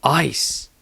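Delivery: usual speaking voice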